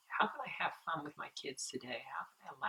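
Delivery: soft whisper to God in the morning; whispered prayer. Retold or imitated.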